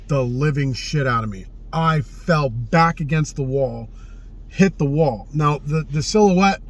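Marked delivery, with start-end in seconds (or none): none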